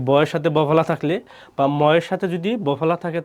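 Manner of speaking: ordinary delivery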